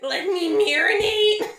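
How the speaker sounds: high, slow mock crying; loud comic sob; hammed-up wail. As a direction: wail it like fake despair.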